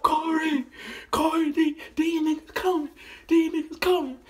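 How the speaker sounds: high, panicked call to his brother; scared kid warning Corey the guys are coming; a boy's frightened high-pitched cry for help. Retold or imitated.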